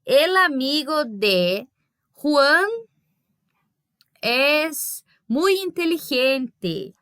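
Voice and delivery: el amigo de Juan es muy inteligente, said very slowly; slow example sentence. Shown as a demonstration. Teacher reading a Spanish example to her class, very slowly. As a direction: read it out slowly, like a model sentence. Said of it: "se eu falasse assim pra vocês".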